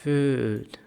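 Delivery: फूड with a long stretched oo; teacher shows the long vowel. Shown as a demonstration. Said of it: "इसको लंबा पढ़ा".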